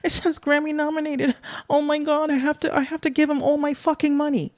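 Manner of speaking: high, breathless mock fan squeal; mock a starstruck gullible artist, high; gushing fan.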